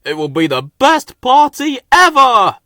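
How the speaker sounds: high, loud little engine cheer; excited peep. A narrator's reading. Little engine cheering about the party, high and loud. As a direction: squeak it out with glee. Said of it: "Freddy peeped".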